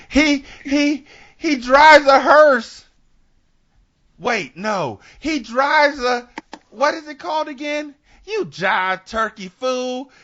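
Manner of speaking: high, drawn-out seventies snitch voice; mock flustered informant. Informant tells Shaft about Sugar Man, mock flustered. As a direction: stammer it out high and stretched.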